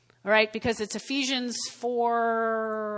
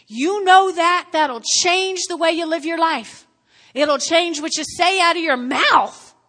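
loud, higher fervent preaching; preaching at full force; 4 semitones higher, 6 dB louder than usual. Acted. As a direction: preach it out with fire. Preaching climax.

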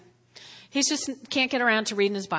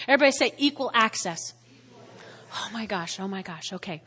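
ordinary talk

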